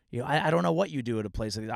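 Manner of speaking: usual speaking voice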